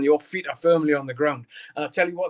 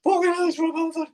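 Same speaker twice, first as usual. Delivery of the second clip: high, louder awed outburst; starstruck exclamation; 12 semitones higher, 4 dB louder than usual. Retold or imitated. Fan meeting his idol [name], starstruck outburst. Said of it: "and I was like".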